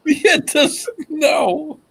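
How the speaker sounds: high-pitched crying laugh; laughing to tears. Real, not acted. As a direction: laugh until it squeaks.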